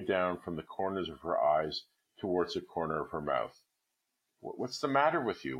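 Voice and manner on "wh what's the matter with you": first ask raised high, shaky and worried; faltering. A narrator's reading. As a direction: stammer out "what's the matter", startled. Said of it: "he faltered".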